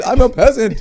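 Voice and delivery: mock peasant. Mock self-deprecating outburst.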